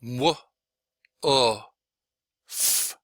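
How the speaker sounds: W, O, F as separate sounds, "wuh, oo, fff"; W, O, F one sound at a time, ending on a voiceless F. Shown as a demonstration. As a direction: break W, O, F apart with long gaps. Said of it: "individual sounds".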